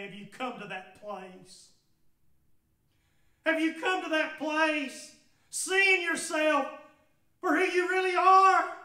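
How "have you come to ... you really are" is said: louder, higher repeated appeal. Shown as a demonstration. Preacher pleading with listeners to see themselves, raised voice. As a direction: push the question out loud and high. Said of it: "can I ask you today".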